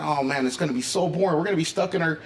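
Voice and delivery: normal talking voice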